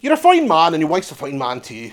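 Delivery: comic put-on Northern Irish accent. Ribbing someone for laughs, Northern Irish accent. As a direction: imitate a Northern Irish speaker. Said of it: "From Northern Ireland".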